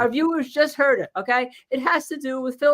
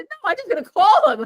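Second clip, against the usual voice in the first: retelling her own line through a laugh, raised; 9 semitones higher, 5 dB louder than usual. Retold or imitated. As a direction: say it while laughing, a bit higher and louder. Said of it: "I said".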